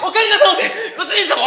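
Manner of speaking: Takeshi impression. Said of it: "俺、たけしばっかりだな".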